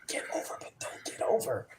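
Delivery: hushed, whispered repeat to oneself; puzzled whisper. Retold or imitated. Rookie looking around, repeating agent's advice, whispering puzzled. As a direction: whisper it as if working out a riddle.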